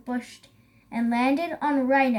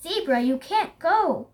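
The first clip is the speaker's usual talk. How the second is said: higher, insistent storybook warning; urgent warning; 5 semitones higher, 3 dB louder than usual. A narrator's reading. Elephant warns Zebra off the Lion's dinner, urgent warning. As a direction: plead firmly with a friend.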